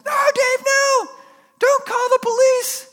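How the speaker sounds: put-on high, loud pleading kid; squeaky-high, loud child's plea; cute child's voice. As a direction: imitate a child crying out. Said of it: "sounds like a cute child's voice".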